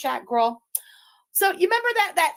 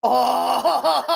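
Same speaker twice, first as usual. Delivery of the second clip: cackle. Real, not acted. Streamer gloats over a rival's defeat, long cackle. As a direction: burst out, then cackle till it fades. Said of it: "any of the cackle".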